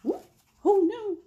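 ordinary delivery

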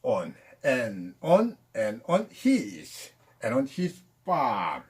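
and on his farm, words run together very slowly; linked-sound demo. Shown as a demonstration. Teacher slowly showing linked English sounds to students. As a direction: link and on his farm smoothly, slow. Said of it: "빠와 아가 붙어 빠".

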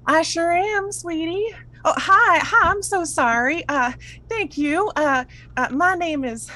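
high, flustered, sweet character voice; folksy lady, apologetic and high; friendly Ursula. Acted. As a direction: introduce yourself sweetly, pitched right up.

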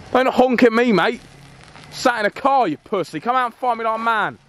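high furious yelling, taunting and goading; squaring up, calling him out; angry shout. Acted. Vlogger taunting the armed driver to come out, angry shout.

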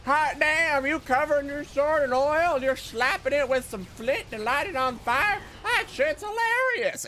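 high, hooting amazement; hammed-up gleeful onlooker; mocked excited Mongolians. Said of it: "the Mongolians were like".

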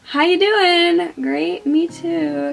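playful high chat. Real, not acted. Mock chat with palette.

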